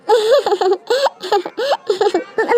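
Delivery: laughing at him, rising; a delighted giggling laugh; amused laugh. Acted. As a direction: she laughs at the crying lover. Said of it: "तुम हंस रही हो".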